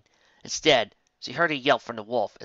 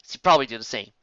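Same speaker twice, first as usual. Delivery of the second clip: ordinary delivery, nothing marked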